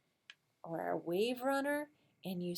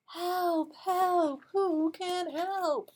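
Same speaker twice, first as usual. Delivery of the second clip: duck calling for help; 9 semitones higher, 4 dB louder, much slower than usual. Acted.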